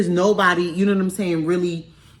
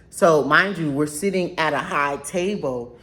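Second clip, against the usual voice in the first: ordinary talk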